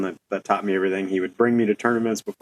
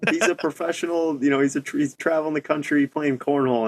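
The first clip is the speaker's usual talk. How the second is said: mom's proud answer; 6 semitones higher than usual. Retold or imitated. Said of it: "it's like".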